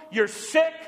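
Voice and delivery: ordinary delivery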